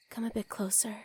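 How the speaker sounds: hushed, intimate whisper; soft whisper. Acted. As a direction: whisper it softly to draw them closer. Flirting, invites partner closer.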